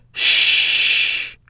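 long breathy shush; hushing shh. A narrator's reading. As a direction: hush a frightened child. Sister hushes frightened boy.

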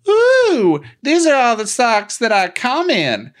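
squealing creepy ghoul; creepy gray man voice. Retold or imitated. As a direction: ham up a lecherous old man.

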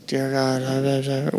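mumbled, dismissive throwaway prayer; hushed, bored mock prayer; rote mock prayer. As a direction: rattle it off like words you don't mean.

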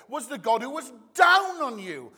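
ordinary talk

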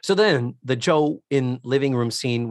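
ordinary delivery

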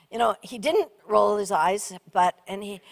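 normal talking voice

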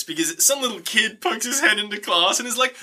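normal talking voice

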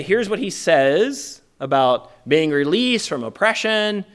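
usual speaking voice

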